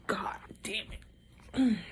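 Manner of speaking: whispered curse through pain. Real, not acted. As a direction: hiss it under your breath. Shoulder pain while lifting arm.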